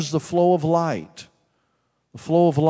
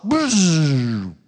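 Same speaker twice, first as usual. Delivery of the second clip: buzzing zap noise; electric buzz; 2 semitones higher than usual. Retold or imitated. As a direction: imitate an electric shock. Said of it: "like an electric shock".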